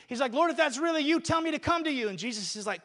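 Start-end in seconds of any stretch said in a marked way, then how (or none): 0.3-2.1 high, loud call across the water. Acted. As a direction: shout it out to Jesus. Peter calls out to Jesus.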